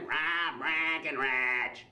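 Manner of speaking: very slow, honked-out Wisconsin vowels; exaggerated Wisconsin accent, goose call. Shown as a demonstration. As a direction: honk the name like Wisconsin geese. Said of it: "sounds like a flock of geese".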